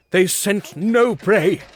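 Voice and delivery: slow, high, loud desperate cry. A narrator's reading. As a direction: drag each word out in horror. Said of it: "cried I".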